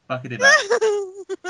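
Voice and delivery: high, loud, drawn-out singsong farewell; catchphrase on request. Retold or imitated. Performing the catchphrase his friend asked for, high singsong. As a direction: stretch it out in a high voice. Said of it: "Say fuckity bye to me".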